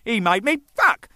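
retold angry shout. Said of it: "I said".

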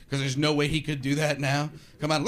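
ordinary talk, nothing marked

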